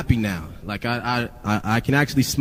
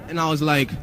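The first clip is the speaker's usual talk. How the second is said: ordinary talk, nothing marked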